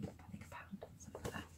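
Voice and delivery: fast secret whisper; confiding a bargain in a whisper. Real, not acted. Confiding a cheap price.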